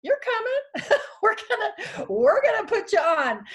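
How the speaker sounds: high, laughing imitation of an eager friend; Mary excited. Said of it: "She said".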